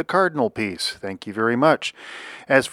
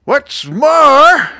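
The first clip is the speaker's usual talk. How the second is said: high, loud creature snap; riled little creature, voice shooting up; snapped; 10 dB louder than usual. A narrator's reading. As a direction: snap indignantly in a very high cartoon voice. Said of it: "snapped the Lorax, his dander was up".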